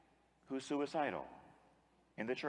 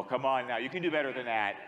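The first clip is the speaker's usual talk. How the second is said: usual speaking voice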